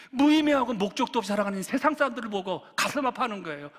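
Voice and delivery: ordinary talk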